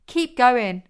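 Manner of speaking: loud, pushing urge; urging. A narrator's reading. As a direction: press him on, loudly. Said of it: "urged Zoya".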